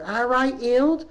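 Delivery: high rising Bristol greeting; one cleaner greeting another, high Bristol voice. Retold or imitated. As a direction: swoop up on Hild like a question. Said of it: "one said to the other".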